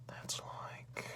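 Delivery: soft whisper while measuring. Acted. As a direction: murmur it under your breath.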